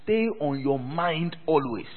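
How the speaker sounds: usual speaking voice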